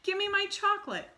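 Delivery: put-on very high, loud spoiled-kid whine; bratty demand. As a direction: demand it like a greedy brat. Boy demands his chocolate.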